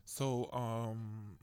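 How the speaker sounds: usual speaking voice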